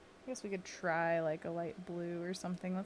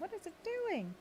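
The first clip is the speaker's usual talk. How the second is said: high, squeaky confused question; high puzzled voice; 12 semitones higher, 3 dB quieter than usual. Pretend. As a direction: ask it like a baffled cartoon.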